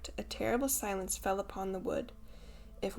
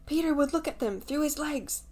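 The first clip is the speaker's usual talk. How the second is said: raised, eager shout of the idea; excited cry; 6 semitones higher, 4 dB louder than usual. A narrator's reading. Lost boys shouting their plan against the wolves, excited. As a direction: blurt out the answer with relief. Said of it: "they cried".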